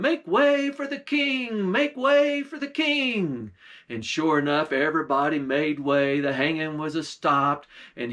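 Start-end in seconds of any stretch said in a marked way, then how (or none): 0.0-1.7 high called-out holler; hollering crowd. Retold or imitated. Crowd hollers for the king to pass, high call. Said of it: "you could hear people a hollering". 1.7-3.5 high, slow drawn-out holler; slow hollering call. Retold or imitated. Crowd repeats the call for the king, slower and high. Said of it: "you could hear people a hollering".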